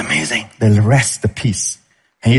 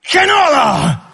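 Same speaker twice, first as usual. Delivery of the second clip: defiant shout; 12 semitones higher than usual. Retold or imitated. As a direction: bark it back like a stubborn soul. Soul refusing to rest.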